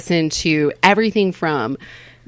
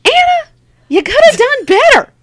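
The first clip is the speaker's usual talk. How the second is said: high-pitched, loud comic scolding; mock self-scolding; 4 dB louder than usual. Comic telling herself off for agreeing, mock scolding. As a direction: berate yourself in a high, punchy voice.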